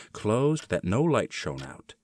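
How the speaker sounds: usual speaking voice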